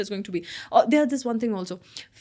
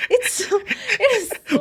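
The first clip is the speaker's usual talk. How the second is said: laughing out, slowly; 12 semitones higher, 4 dB louder, slower than usual. Real, not acted. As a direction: laugh through the words. Laughing in exasperation.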